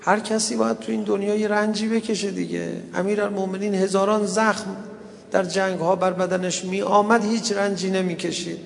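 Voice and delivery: high, sustained lamenting tone; mourning sermon. Acted. Preacher tells mourners of Ali's suffering, plaintive recital. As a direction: recite it as a sorrowful elegy.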